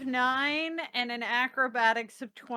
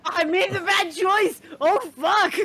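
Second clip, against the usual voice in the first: real alarmed outburst, a bit louder; panicked swearing; 2 semitones higher, 5 dB louder than usual. Real, not acted. Player reacting to the dragon charging, panicked swearing. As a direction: blurt it as the dragon strikes. Dragon attacks her character.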